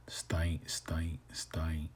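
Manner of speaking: "stein" whispered softly, ending cut sharp; whispered ending drill. Shown as a demonstration. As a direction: whisper "stein" with a hard stop at the end. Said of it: "they all end in a glottal stop".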